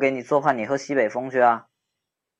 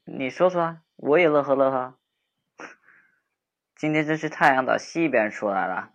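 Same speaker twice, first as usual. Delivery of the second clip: put-on playful bantering young man walking her home; teasing boyfriend-type friend. Teasing friend walking upset girl home, playful banter. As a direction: tease her, then soften and comfort.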